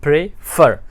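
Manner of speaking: Prefer split, weight on फर; light pray, pause, firm फर; stress on last syllable. Shown as a demonstration. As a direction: hit the second beat of prefer. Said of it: "दूसरे पे ज़ोर है".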